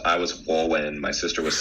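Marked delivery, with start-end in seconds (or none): 0.5-1.0 put-on "Walwyn" with childish W for R; R's and L's as W. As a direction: say "Walwyn" like a kid who can't do R's. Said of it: "I couldn't do R's or L's".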